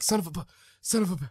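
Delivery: high, rapid cartoon stutter; stuttering Porky Pig. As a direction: trip over the words in quick, high bursts. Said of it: "he was stuttering".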